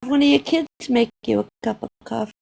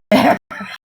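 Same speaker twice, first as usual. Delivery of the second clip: laugh; 3 semitones higher, 5 dB louder than usual. Real, not acted. Amused at being taken for leaving, laughing. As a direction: open laugh out loud.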